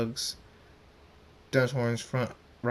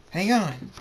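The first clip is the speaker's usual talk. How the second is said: calling out; 7 semitones higher, 5 dB louder than usual. A narrator's reading. Flyer calls out before takeoff.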